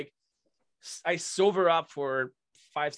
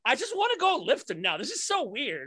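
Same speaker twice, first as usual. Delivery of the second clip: adrenaline buzz; 8 semitones higher than usual. Retold or imitated. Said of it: "adrenaline's like".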